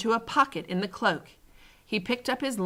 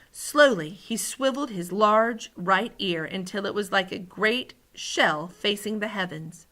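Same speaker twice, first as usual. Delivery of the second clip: usual speaking voice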